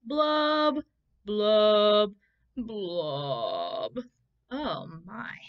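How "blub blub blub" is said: sad blub blub. Acted. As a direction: let each blub sink down. Refrain again.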